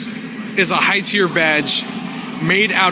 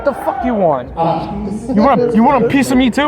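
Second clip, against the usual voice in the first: put-on belligerent drunk; 5 semitones higher than usual. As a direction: pick a fight, drunk and rowdy. Shouting at bystanders, drunk trip.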